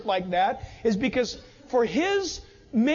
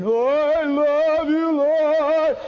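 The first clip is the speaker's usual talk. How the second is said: slow, louder mock cry of "Oh, I love you"; imitating loud prayer; 3 semitones higher, 6 dB louder, much slower than usual. Mimicking the neighbour heard through the wall each morning, slow and loud. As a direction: belt it out like the neighbour praying at dawn. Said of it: "you'd hear Richard".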